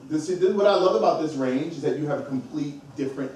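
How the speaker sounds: usual speaking voice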